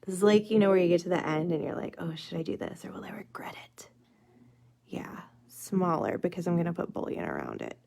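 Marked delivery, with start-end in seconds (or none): 2.0-3.9 mock whisper. Acting out her own doubt. 4.9-7.8 whisper. Real, not acted. Deciding quietly over the nail.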